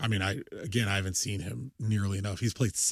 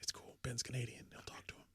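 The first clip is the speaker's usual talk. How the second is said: reassuring hush; calm him down in a whisper; 18 dB quieter than usual. Real, not acted. Reassuring cohost about Canadian colleague, whispered. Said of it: "I don't know if we've ever whispered like this".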